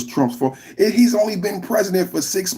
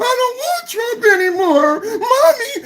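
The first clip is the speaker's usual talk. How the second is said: much higher, wailing mock sob of a grown man acting like a baby; mock crying baby. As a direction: whine and wail like a toddler crying for mommy. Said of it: "start crying to your mommy like a baby".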